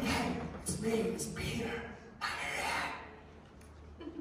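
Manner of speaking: hushed, breath-only mock whisper; acted furtive whisper. Peter outside the gate asking in, urgent stage whisper. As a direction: whisper it so as not to draw attention. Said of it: "not too loud because he doesn't wanna draw attention".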